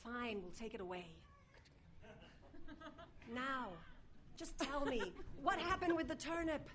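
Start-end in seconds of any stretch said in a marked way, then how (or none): none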